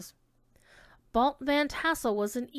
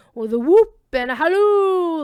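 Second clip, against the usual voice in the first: a loud, rowdy call out; whooping halloo; 6 semitones higher, 12 dB louder than usual. A narrator's reading. Narrator shouting the riders' midnight whoop and halloo.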